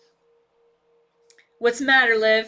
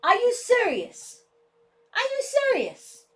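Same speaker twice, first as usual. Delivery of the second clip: exasperated; 11 semitones higher than usual. Real, not acted. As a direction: drag out disbelief at the pet. Exasperated at the dog.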